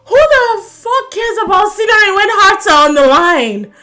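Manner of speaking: high, loud, fed-up outburst; exasperated rant. Retold or imitated. Vlogger ranting at hosts, loud exasperated outburst. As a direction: rant as if your heart is on the line. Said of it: "So I was like".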